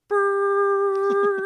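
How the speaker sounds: long, loud, high horn blast; imitated ship horn. Imitating the recorded cruise call, loud horn noise.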